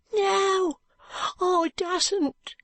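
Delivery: put-on high, pleading boy's voice on the edge of tears; a frightened ragged boy refusing; tearful street boy. Joe refuses Alan, near tears.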